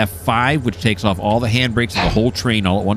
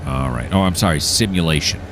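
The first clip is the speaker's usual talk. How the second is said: normal talking voice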